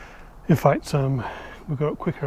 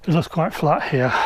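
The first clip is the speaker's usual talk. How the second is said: usual speaking voice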